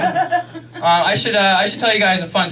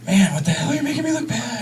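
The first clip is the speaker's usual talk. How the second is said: higher, put-on puppet voice; sock puppet voice; 8 semitones higher than usual. Sock puppet talking back.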